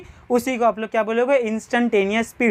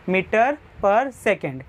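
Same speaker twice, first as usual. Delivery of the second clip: slow dictation; much slower than usual. Shown as a demonstration. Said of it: "teacher dictating unit".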